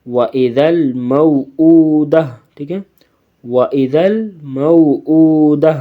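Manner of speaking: نو، ہی، drawn out slowly in Quran recitation style; waqf stop reading. Shown as a demonstration. Teacher shows pupils the waqf reading, slow and drawn. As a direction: stretch نو، ہی، slowly, teacher demonstrating a pausal reading. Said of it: "وَقْفًا".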